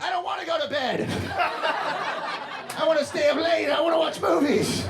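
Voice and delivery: hammed-up kid tantrum, very high pitched; twelve-year-old boy. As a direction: protest like a child refusing bedtime, way up high. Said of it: "for a twelve-year-old boy".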